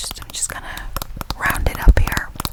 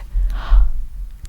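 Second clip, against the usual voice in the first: gasp; 5 dB louder than usual. Real, not acted. Fears she burned the camera.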